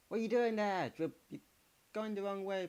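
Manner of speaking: high exasperated complaint; exasperated at the mistake; frustrated. Real, not acted. Frustrated at a player.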